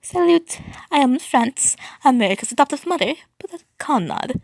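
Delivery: put-on high, cheerful French accent; happy French accent. A narrator's reading. Adoptive mother France introducing herself, happy French accent. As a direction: greet everyone brightly with a French accent. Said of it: "spoke happily in a French accent".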